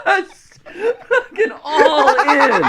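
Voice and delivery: high, loud, drawn out through laughter; cracking up. Real, not acted. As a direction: squeal it out while laughing. Still cracking up at story.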